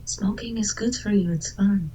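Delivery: conspiratorial whisper. Acted. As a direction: whisper bad advice to a child. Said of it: "I'm whispering to this child".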